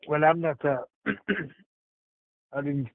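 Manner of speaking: ordinary delivery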